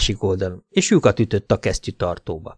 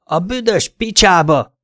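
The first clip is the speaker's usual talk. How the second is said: high, drawn-out shouted curse; acted shout; 9 semitones higher, slower than usual. A narrator's reading. Detective runs downstairs from Jack Bass, shouted curse. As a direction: shout the curse with raised pitch, slowly. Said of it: "ordítottam".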